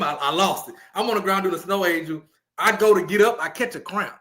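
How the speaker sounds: ordinary delivery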